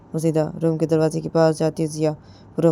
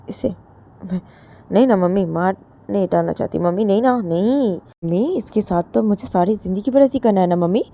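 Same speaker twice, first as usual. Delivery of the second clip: sly whining; 4 semitones higher, 5 dB louder than usual. Acted. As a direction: play innocent while meaning harm. Jiya whines to mother.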